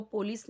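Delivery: पुलिस as "po-lis"; पुलिस starting "po", not "pu"; wrong pronunciation. Shown as a demonstration. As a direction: say पुलिस with an o in the first syllable. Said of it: "वो पुलिस नहीं होता".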